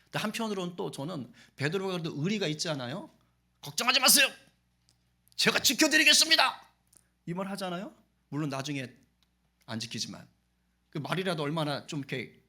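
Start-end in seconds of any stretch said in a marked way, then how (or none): 3.6-6.7 high, loud eager promise; Peter's bold vow. Retold or imitated. Peter vowing loudly to protect Jesus, high and loud. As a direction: proclaim it like a devoted disciple.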